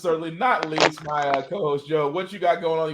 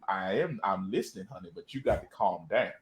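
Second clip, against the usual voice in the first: low, soft, soothing drone; imitating calm boyfriend; 9 semitones lower, 9 dB quieter than usual. Boyfriend calming partner in a low soothing drone. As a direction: settle her down, deep and hushed. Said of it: "I can't see G having too many arguments".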